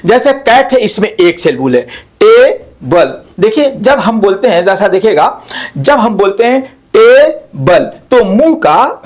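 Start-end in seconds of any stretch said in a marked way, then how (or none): 2.2-3.2 table in two beats, TE, then BUL; split into syllables. Shown as a demonstration. Teacher showing students the two mouth shapes, split into syllables. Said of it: "मुंह का जो आकार दो बार change होता है". 7.0-7.8 table in two beats, TE, then BUL; split into syllables. Shown as a demonstration. Teacher showing students the two mouth shapes, split into syllables. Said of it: "मुंह का जो आकार दो बार चेंज होता है".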